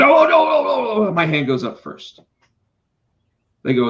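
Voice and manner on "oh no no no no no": eager. Retold or imitated. Eager to answer a trivia question.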